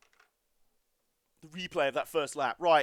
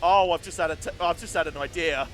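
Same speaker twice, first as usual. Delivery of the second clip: excited; 8 dB louder than usual. Real, not acted. Sudden idea mid-race.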